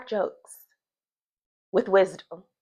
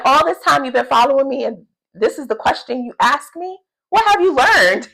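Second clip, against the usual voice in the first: snarky rebuttal; 7 semitones higher than usual. Retold or imitated. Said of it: "I thought that was a funny rebuttal".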